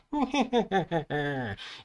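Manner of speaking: high goblin cackle; put-on creature giggle; goblin laughter. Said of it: "los duendes se reían".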